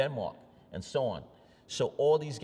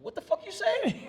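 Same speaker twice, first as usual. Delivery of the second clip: laughing through a much higher retold question; laughing quote; 4 dB louder than usual. Retelling the German's line to the host, laughing through it. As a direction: crack up while quoting him.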